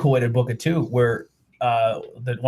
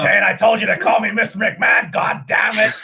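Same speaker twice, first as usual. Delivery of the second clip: higher, shouted mock scolding; angry Vince impression; 8 semitones higher, 4 dB louder than usual. Co-host voicing Vince scolding Shane on the phone, mock shout. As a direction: scold your son like a furious father.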